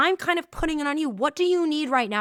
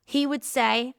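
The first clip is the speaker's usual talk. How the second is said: usual speaking voice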